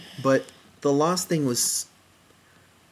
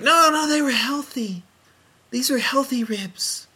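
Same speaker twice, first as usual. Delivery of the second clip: much higher, squeaky mock defensive voice; mock her, insisting her ribs were healthy; defensive Michelle Obama; 11 semitones higher than usual. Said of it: "And she's like".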